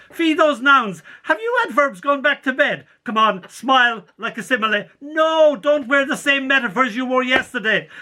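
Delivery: put-on high, hurried mother chivvying the kids; fussing mother. Mother rushing the children out in the morning, high and fussing. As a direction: bustle them off like a busy mum. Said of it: "all the things my mother would say".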